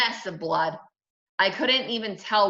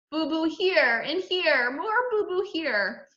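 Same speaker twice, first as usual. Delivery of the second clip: put-on high, slow baby talk; whimpering toddler; 9 semitones higher, slower than usual. A narrator's reading. Little brother showing his wounds, plaintive toddler voice. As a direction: show each hurt in a slow little-kid voice.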